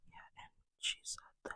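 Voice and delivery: barely audible, voiceless whisper; proper whisper. Shown as a demonstration. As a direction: whisper it as softly as possible. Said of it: "It's supposed to be like".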